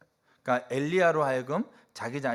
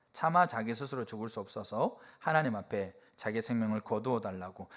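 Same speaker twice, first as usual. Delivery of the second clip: normal talking voice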